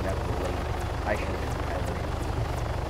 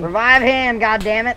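frustrated yell at teammate; 13 semitones higher, 12 dB louder than usual. Real, not acted. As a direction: shout it out in anger. Calling out to teammate.

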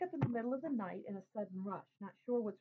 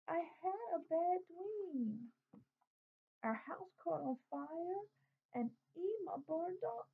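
put-on much higher, slow child's voice near tears; a small boy telling his nightmare, lightly tearful; little brother tells his bad dream, scared; frightened little boy; 9 semitones higher, slower than usual. A narrator's reading. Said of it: "he starts to cry".

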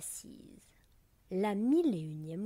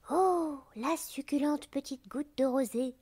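high, louder cry of relish; delighted exclamation; 6 semitones higher, 4 dB louder than usual. A narrator's reading. Blue butterfly spotting a juicy dewdrop, exclaiming greedily. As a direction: exclaim over a delicious find. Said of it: "s'écria-t-il en voyant Perlette".

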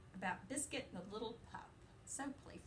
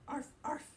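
puppy yip; 4 semitones higher, 5 dB louder than usual. Acted. Puppy barks in the story.

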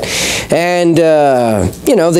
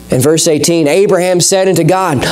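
ordinary talk, nothing marked

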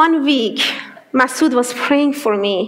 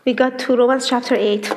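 ordinary talk

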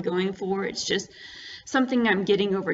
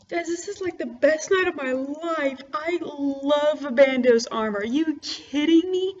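high, loud giddy excitement; bursting with real joy; thrilled; 7 semitones higher, 4 dB louder than usual. Real, not acted. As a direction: gush like it's the best night ever. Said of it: "I'm just so freakishly excited".

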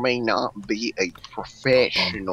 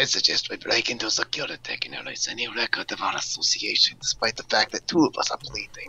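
whispering; 4 dB quieter than usual. Acted. Whispered briefing.

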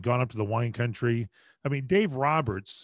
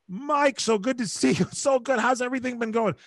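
high, bright-eyed celebrity greeting voice, much higher and louder; imitating John Stamos; 11 semitones higher, 3 dB louder than usual. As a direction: gush like a star greeting an old friend. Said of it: "He went".

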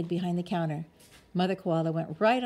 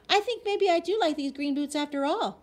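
hasty eager agreement; 10 semitones higher, 5 dB louder than usual. A narrator's reading. Girl hastily changes her mind.

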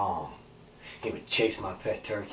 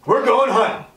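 loud, high-pitched whoop; excited shout; 16 dB louder than usual. Acted. Thirteen-year-old thrilled by his new shotgun, excited shout. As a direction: burst out with boyish excitement. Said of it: "I was so excited".

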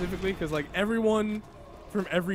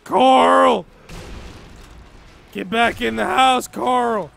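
loud mock-dramatic holler; Rick from The Walking Dead; 3 semitones higher, 11 dB louder, much slower than usual. Mimicking a TV father ordering his son inside, shouting. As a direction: bellow like a desperate father calling his boy. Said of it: "you just see Rick from, uh, The Walking Dead".

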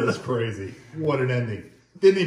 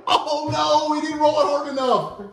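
excited commentary; 10 semitones higher than usual. Real, not acted. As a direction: call it like a sportscaster groaning. Excited bowling play-by-play call.

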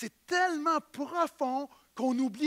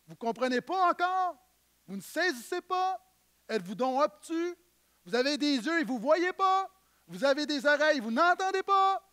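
exasperated Jesus; 5 semitones higher than usual. Acted. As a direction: fire the questions in disbelief. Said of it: "Et leur dit".

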